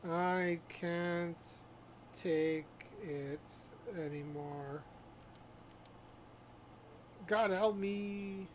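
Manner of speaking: drawn out, halting, fading; slow, labored plea from someone failing; weakened sufferer. Acted. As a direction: play it weak and suffering. Acting a poisoned character's last gasps.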